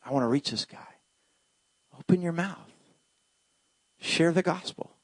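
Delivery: hushed divine nudge; God's quiet voice. Retold or imitated. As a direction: speak it gently like a whisper inside. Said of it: "He's like".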